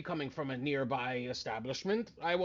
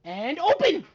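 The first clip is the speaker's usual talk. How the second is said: card magician patter; 10 semitones higher, 8 dB louder than usual. Acted. Magician reveal.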